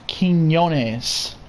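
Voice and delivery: Quinones said keen-YO-nez, slowly and carefully; Spanish pronunciation. Shown as a demonstration. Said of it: "It's pronounced Quinones".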